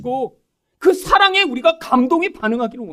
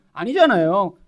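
ordinary delivery

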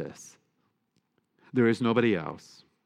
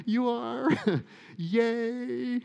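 very high, giggly little cheer; laughing mock cheer; much slower than usual. Priest joking that they'll come to Jesus, laughing mock cheer. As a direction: squeal it playfully through laughter.

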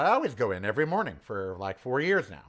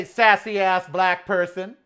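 slow, loud and higher insult; loud slow comeback; 6 semitones higher, 8 dB louder, slower than usual. Retold or imitated. Answering the guard's insult, loud and slow. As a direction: fire the insult right back. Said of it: "I say".

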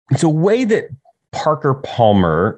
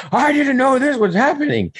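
high-pitched mock protest; mock complainer; 12 semitones higher than usual. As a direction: imitate an indignant, caught-unawares churchgoer, much higher. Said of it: "there will be somebody".

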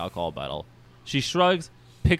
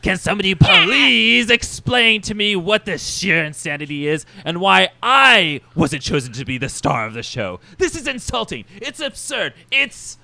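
indignant, higher-pitched villain rant; Mister Sinister; 6 semitones higher, 6 dB louder than usual. Acted. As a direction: protest like an affronted diva. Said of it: "I can't do Sinister".

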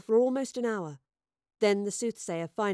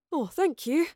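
beaming; 6 semitones higher, 4 dB louder than usual. A narrator's reading. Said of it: "beamed Gaius".

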